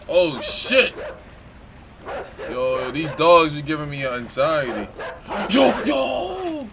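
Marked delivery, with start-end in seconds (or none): 0.1-0.9 sudden loud alarmed shout; startled yell. Real, not acted. Startled by dogs in game. 5.5-6.7 panicked shout. Real, not acted. Panicked by dogs in game.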